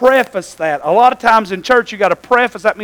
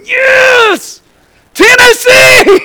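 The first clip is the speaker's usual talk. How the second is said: excited shouting; 10 dB louder, much slower than usual. Retold or imitated. Preacher imitates boy cheering on the drive home, excited shouting. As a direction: imitate a boy's high, drawn-out victory yell. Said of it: "That boy started shouting".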